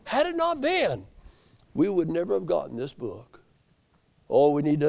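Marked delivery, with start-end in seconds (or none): 0.0-3.4 high, emphatic pulpit delivery; impassioned preaching. Acted. Preacher warning that the devil changes scripture, impassioned. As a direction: hold up the book and cry it out high.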